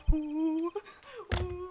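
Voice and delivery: long held hoot, then another; loud high excited "ooh". Real, not acted. Player reacting to the game reveal, excited hooting. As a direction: let out loud, drawn-out oohs.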